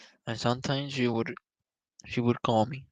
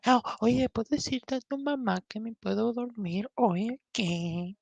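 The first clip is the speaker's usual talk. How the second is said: higher, pitched-up imitation of her mother; mock mom voice; 12 semitones higher, 3 dB quieter than usual. Imitating her mother asking her to sleep. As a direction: play the girlfriend's mom, high and nagging.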